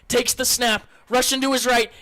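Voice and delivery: ordinary talk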